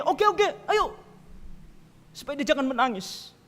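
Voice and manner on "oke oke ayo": high indulgent mock parent; much higher, yielding parent voice; giving-in parent. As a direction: cave in sweetly.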